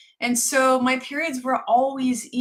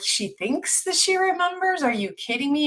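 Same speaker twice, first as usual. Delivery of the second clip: incredulous; 6 semitones higher than usual. Retold or imitated. Retelling disbelief at lost blood work.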